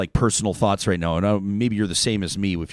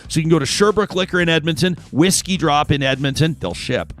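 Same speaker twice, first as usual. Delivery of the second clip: upbeat, louder sales pitch; ad read; 4 semitones higher, 4 dB louder than usual. Acted. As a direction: sell the bourbon to listeners. Bourbon ad read over music.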